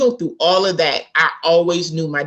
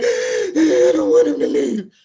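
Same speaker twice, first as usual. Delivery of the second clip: high, slow mock crying plea; imitating a tearful child begging; retold sobbing plea, high and slow; 10 semitones higher, slower than usual. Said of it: "I'm crying like".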